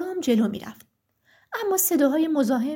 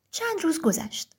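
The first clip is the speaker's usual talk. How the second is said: ordinary delivery, nothing marked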